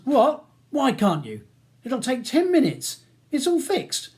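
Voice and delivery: high, agitated protest; indignant. A narrator's reading. Ronnie demanding Charles step in for his brother, indignant. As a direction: demand a reason, voice jumping up in anger.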